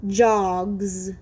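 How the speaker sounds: Jogs said clearly, ending on a voiced z; teacher modelling a word. Shown as a demonstration. Teacher to class, modelling the word ending. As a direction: model Jogs, buzzing the final z. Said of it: "How about the word jogs".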